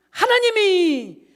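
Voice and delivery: 하나님이 shouted high and drawn out slowly; shouted answer. Acted. Answers own question, shouting.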